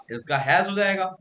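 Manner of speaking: usual speaking voice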